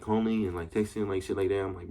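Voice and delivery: ordinary talk, nothing marked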